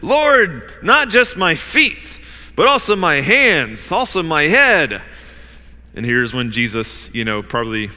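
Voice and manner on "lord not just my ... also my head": higher, louder, slow emphatic plea; over-eager outburst, punching each item; abrupt response. Acted. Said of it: "abruptly responds".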